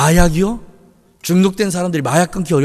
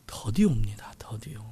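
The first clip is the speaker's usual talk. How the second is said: whisper; 8 semitones lower, 12 dB quieter than usual. Real, not acted. Hushed warning in the sermon.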